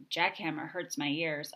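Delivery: ordinary delivery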